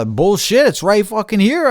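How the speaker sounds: loud, high-pitched angry protest; indignant retort. Retold or imitated. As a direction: argue back, voice jumping up high. Arguing back at the seller.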